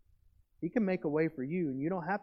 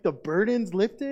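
ordinary talk, nothing marked

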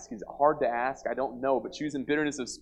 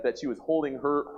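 ordinary delivery

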